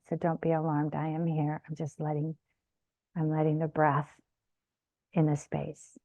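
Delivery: quiet, settling meditation voice; soft reassurance. Real, not acted. Meditation teacher reassuring the group during silence, soft and quiet. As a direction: let it sink softly, leaving space between phrases.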